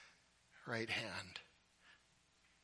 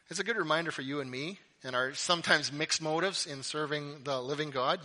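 ordinary delivery

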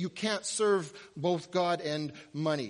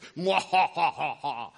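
evil gloating cackle; mad scientist laugh; 2 semitones lower than usual. Pretend. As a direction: cackle like a villain. Gloating laugh after fantasy.

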